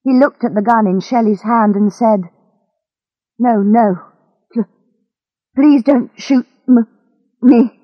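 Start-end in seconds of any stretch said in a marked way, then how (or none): 3.4-7.8 pleading, voice rising as words catch; scared, broken-up plea with halting restarts; frightened stammer. Acted. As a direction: beg for your life, tripping on the words. Man begs at gunpoint.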